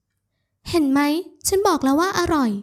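high-pitched told-you-so; triumphant woman character. A narrator's reading. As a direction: crow about it in her high voice. Woman gloats about her cooking. (พูดอวดเสียงสูงอย่างผู้ชนะ)

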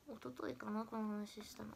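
ordinary delivery

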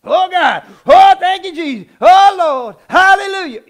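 loud, high, hammed-up holy prayer voice; mock churchy praying. Mocking how churchgoers pray, loud and high. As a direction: overdo the fervent church prayer. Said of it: "we feel like we gotta turn into something".

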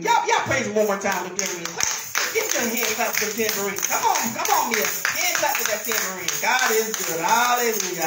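loud church exhortation; rally the congregation to clap and praise; rousing call. Real, not acted.

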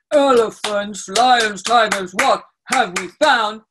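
loud, put-on deep chanting voice; booming mock-deep voice on the beat; very low voice. Shown as a demonstration. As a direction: chant it big and deep for the children. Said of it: "make a very low voice".

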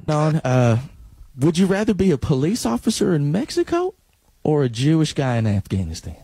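ordinary talk, nothing marked

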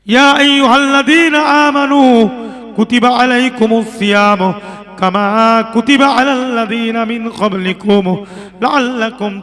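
loud, sing-song sermon chant with drawn-out phrase endings; ওয়াজের সুর. Real, not acted. Chanted recitation and translation. (সুরেলা উচ্চকণ্ঠে ওয়াজ)

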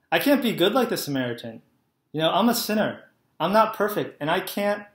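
usual speaking voice

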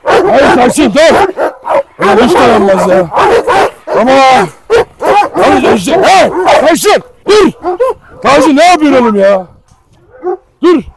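high, loud desperate shouts at the dog; alarmed yelling. Real, not acted. Owner yells at Tyson to stop fighting. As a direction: shout him off the other dog.

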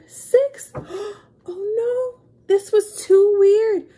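high, loud gasping shock. Acted. Reader reacting to only six cupcakes left. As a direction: gasp it out in disbelief.

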